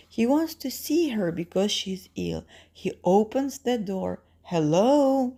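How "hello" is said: calling out, raised and loud; father. Acted. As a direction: call hello into the house. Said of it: "he says".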